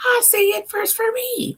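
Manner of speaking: high mocking voice claiming first; squeaky childish boast; mock whiny brag. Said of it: "I'm like".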